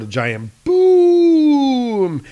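high, slow, drawn-out booming effect; mock boom. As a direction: boom it out like a comic explosion. Sound effect narration.

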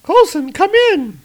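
calling for help mid-fight; urgent radio call. Acted. Roz radios Coulson.

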